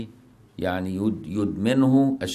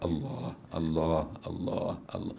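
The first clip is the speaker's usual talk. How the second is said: hushed repeated Allah, much softer; soft dhikr; 4 semitones lower, 8 dB quieter than usual. Shown as a demonstration. Teacher demonstrating the single-name dhikr, hushed. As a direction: breathe the name softly as remembrance. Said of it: "السلوك بالاسم المفرد".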